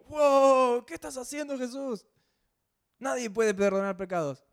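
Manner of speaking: high, loud astonished wow; shocked crowd. Retold or imitated. Onlookers are shocked that Jesus forgives sins, a high loud wow. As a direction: react as if he just said something outrageous.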